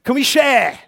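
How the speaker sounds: loud preaching appeal; rallying call. Acted. Preacher rallying the congregation, loud appeal. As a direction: call the church to answer.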